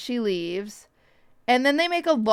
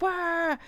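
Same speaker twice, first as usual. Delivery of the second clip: mock crowd squeal; 6 semitones higher, 7 dB quieter than usual. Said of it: "crowds of people just be like".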